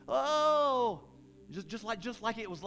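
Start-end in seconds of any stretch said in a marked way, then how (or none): 0.1-1.0 loud, high wailed "oh"; mock mourning moan. Shown as a demonstration. Imitating paid professional mourners at a funeral, loud theatrical moan. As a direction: wail it like a hired mourner. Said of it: "and cry and moan".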